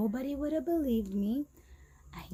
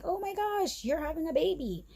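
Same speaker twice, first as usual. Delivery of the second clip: imitating doctor; 9 semitones higher than usual. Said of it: "he's like".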